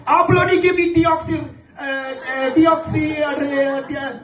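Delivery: high dad voice tripping over deoxy; stumbling angry dad. Retold or imitated. Dad trying to repeat deoxyribonucleic, high and stumbling. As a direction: fumble the long word as a furious dad. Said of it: "my dad was like".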